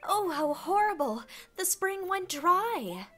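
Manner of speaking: high-pitched, melodramatic flower lamenting; distraught flower damsel. Acted. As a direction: wail theatrically as a helpless damsel. Voices melodramatic flower Lily.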